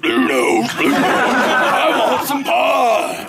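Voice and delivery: big roaring bellow, drawn out slowly; hammed-up creature roar; corrected monster voice. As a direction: bellow it like a huge hungry beast. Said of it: "she doesn't talk like that, excuse me".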